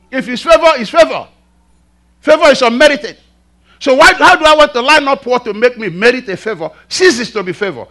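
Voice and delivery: high, loud preacher's challenge; impassioned preaching. Acted. Preacher challenging fellow Christians on favor, impassioned.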